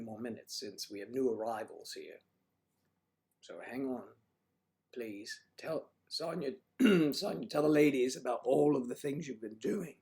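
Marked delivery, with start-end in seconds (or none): 5.6-9.9 a hint of a louder, higher showman voice; Mister Douglas voice. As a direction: play a host calling on Sonya. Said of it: "my Mister Douglas voice".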